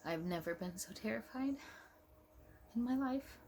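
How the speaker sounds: real choked-up voice, rising high at the end; fighting tears. Real, not acted. Mother recalling her terror at the birth, fighting tears. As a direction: hold back crying as the pitch climbs. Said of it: "I'm trying not to cry".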